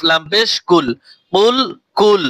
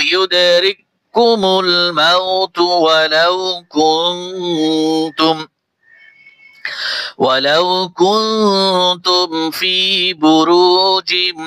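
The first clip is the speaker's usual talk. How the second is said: slow, melodic tajweed recitation; measured Quranic recitation; much slower than usual. Acted. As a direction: chant the verse slowly with tajweed.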